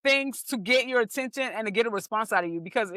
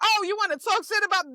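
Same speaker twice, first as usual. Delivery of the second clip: high mock outrage; riled comeback; 10 semitones higher, 3 dB louder than usual. Comedian imitating a public figure answering a troll, riled. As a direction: retort as a provoked celebrity, pitched right up. Star snapping back at troll.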